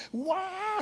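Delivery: grito muy agudo imitado. Retold or imitated. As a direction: suelta un guau estridente. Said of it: "Seguía gritando". (grito infernal en la noche, imitado muy agudo)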